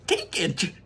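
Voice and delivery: eager cry in Wonka voice; excited. Acted. Wonka urges Charlie, excited. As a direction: urge him on. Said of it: "growing more and more excited".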